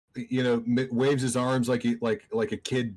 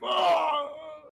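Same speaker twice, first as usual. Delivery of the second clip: high yelp. Acted. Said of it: "he's like".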